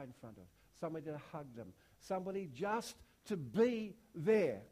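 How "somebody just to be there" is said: louder and slow on the last item; swelling emphasis. Real, not acted. Preacher closing the list, louder and slow. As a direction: lift the list's final line.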